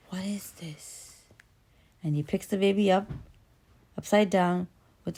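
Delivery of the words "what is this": slow monster whisper; baffled monster. Acted. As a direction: whisper it slowly, mystified. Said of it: "So the monster goes".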